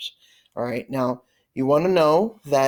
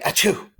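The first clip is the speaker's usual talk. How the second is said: higher, spoken "achoo" sneeze; mock sneeze for an example; pretend sneeze; 6 semitones higher than usual. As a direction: fake a sneeze as a word. Imitates the sneeze he describes.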